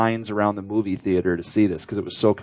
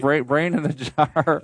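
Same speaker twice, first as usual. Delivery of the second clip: slow robot brain voice; 3 semitones higher, slower than usual. Pretend.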